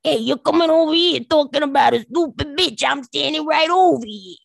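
Italian-American tough-talk shout; Italian-American confrontation. Retold or imitated. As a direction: square up like a Brooklyn Italian. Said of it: "I felt myself going".

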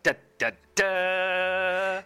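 sung fanfare that rises to a loud, high "Duh!"; mock fanfare, loud on the final Duh; mock dramatic fanfare. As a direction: hit the last note hard and high, a punchline sting. Fanfare as he is put on the spot.

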